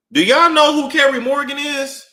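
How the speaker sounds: shouting the question at the audience; loud disbelief. Real, not acted. Asking the viewers who Carrie Morgan is, loud and high.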